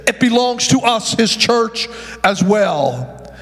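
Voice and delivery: high, sustained preacher's cadence, half sung; fervent preaching. Acted. Pastor to his congregation about heaven's throne, fervent sung preaching. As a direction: lift the congregation with a singing, rising preach.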